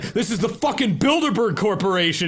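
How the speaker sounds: shrieked mock paranoid outburst; conspiracy rant. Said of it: "you should have said".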